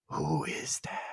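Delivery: a hushed, scared mimicry of the line. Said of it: "so scared he lost his accent".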